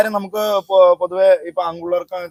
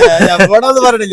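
drawn-out, raised shout; loud protest; 4 semitones higher, 13 dB louder than usual. Real, not acted. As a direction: stretch the complaint out loud and high. Teasing outcry, repeated complaint. (നീട്ടി ഉച്ചത്തിൽ പരാതി)

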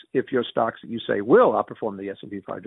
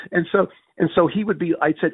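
ordinary delivery

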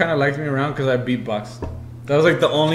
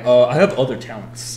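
resentful; 4 semitones lower than usual. Retold or imitated. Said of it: "I was fucking resentful".

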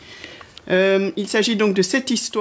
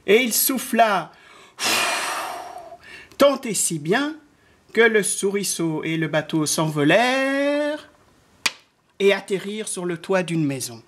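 put-on children's story narration. A narrator's reading. Narrator telling how the wind blew, lilting storytelling. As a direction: tell it with suspense to small children.

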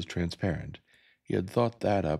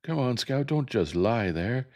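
screaming; 8 semitones higher, 4 dB louder than usual. Acted. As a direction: scream at her to get up. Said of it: "Jem was screaming".

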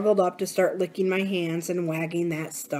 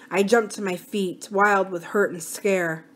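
plain reading voice

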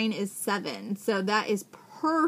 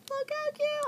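high cute coo. Real, not acted. Cooing at a mini pad.

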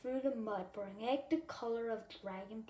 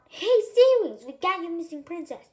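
much louder, higher hail across the ocean; mock shout; 6 semitones higher, 14 dB louder than usual. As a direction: call out to the Sea Wings as if from the shore. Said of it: "We shout".

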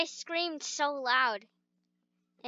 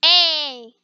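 shouting; 8 dB louder than usual. Real, not acted. Kids shouting in empty gym.

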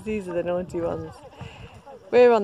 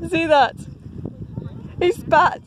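louder, higher, laughing disbelief; amused excitement; 8 semitones higher, 4 dB louder, slower than usual. Real, not acted. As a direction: point it out, delighted. Alpaca spat at John.

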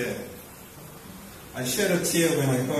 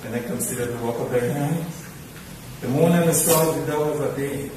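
scripture recitation. Acted. Mourner reciting a psalm for his late teacher, measured. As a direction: recite it like a Sunday school lesson.

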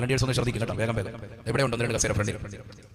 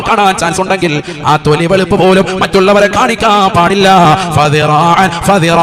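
loud, intoned sermon with long-held line endings; full-force preaching; 6 semitones higher, 14 dB louder, slower than usual. Real, not acted. Preacher rebukes the community over social media, loud chanted exhortation. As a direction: preach at full volume, rising high and chanting the closing words.

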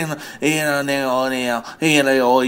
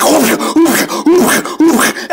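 grandma grunting; 9 semitones higher, 4 dB louder, slower than usual. Pretend. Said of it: "She will race you around the house like".

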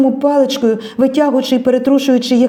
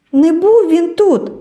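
Tereza's denial; 8 semitones higher, 3 dB louder, slower than usual. A narrator's reading. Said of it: "відповіла Тереза".

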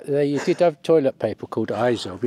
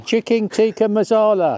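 put-on laughing Indian accent; 8 semitones higher, 4 dB louder than usual. Wife naming the dish in an Indian accent for the camera. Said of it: "do that accent again".

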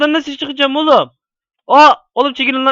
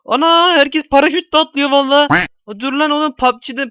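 ordinary delivery

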